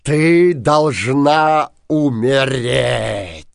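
loud, very slow menacing verdict. A narrator's reading. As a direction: pronounce her death sentence slowly. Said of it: "грозно сказал Синяя Борода". (грозно и медленно)

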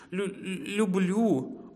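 high, slow stammer; frightened hare. Acted. Hare answering the wolf, scared stammer. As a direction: stretch the word out, stuttering with fear.

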